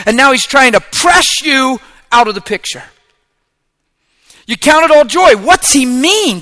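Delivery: high and loud, driving every phrase; full-force preaching. Acted. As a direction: preach it hard to the congregation.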